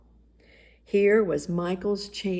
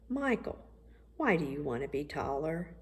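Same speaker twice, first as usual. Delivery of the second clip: softer, lower-key gentle asking; soft fatherly question; 3 semitones lower, 7 dB quieter than usual. A narrator's reading. Daddy on the steps gently asking his son, softer. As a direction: ease into it quietly, like a dad.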